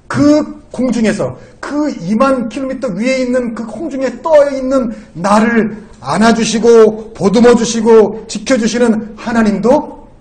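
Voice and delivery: loud, high, slow preaching; full-force preaching. Real, not acted. Preacher exhorting the congregation to trust God, full-force preaching. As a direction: hammer out each phrase to the congregation.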